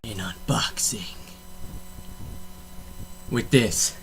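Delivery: hushed whispered repeat. Pretend. Vlogger echoing his intro in a whisper. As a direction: breathe the words like a secret.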